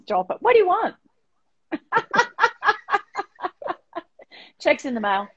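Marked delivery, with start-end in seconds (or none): 1.7-3.7 high, tickled laugh after the flattery. Real, not acted. Flattered by the host's compliment, she laughs high.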